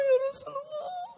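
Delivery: high acted wail; trembling tearful cry. Man shaken by hearing of the Prophet, tearful cry. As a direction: call out high, as if crying and shaking. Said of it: "Gemeteran badan".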